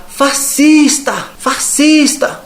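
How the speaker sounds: high, slow drawn-out yell of fascista; imitating the woman yelling across the church; retold shout. Imitating a woman shouting accusations in church, high drawn-out yell. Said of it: "Aí ela pegou e gritou lá".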